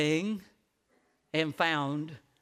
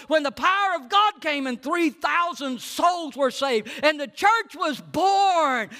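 climbing, louder preacher's list; preaching at full force; 8 semitones higher, 5 dB louder than usual. Acted. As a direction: build each miracle higher.

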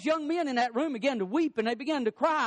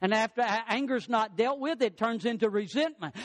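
normal talking voice

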